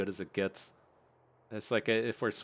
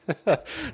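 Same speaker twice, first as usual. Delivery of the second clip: sudden high laugh; burst of laughter; 11 semitones higher, 6 dB louder than usual. Real, not acted. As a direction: laugh out loud. Laughing at a funny clip.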